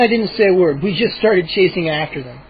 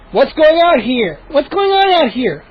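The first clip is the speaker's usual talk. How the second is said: calling out; 7 semitones higher than usual. Acted. Mindy calling out from the porch. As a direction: call out from the porch. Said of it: "a familiar voice called".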